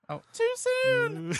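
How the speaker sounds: very high, slow, stretched squeal; mock squeal. Mock-shocked after his own dark joke, a very high, slow squeal. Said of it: "mock regret after a dark joke".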